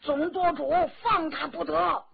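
high, urgent shout of protest; alarmed, insistent objection; crying out. A narrator's reading. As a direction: call out to stop the chief. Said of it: "都叫了起来".

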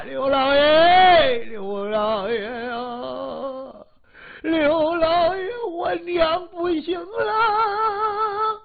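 high, long-drawn mournful cries; theatrical wailing. Acted. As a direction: wail out to the master like a mourner putting on a show. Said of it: "一个悲悲惨惨 哭的这叫惨啊".

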